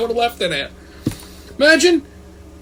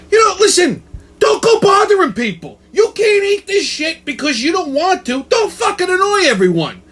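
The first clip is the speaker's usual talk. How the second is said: pitched-up comedic ranting; exasperated rant; 11 semitones higher than usual. Acted. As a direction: rail at picky eaters, pitched way up. Ranting at picky eaters.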